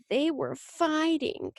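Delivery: put-on high, slow little-girl tattling; coy. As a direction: tattle coyly, drawing out the words. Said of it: "smiled coyly".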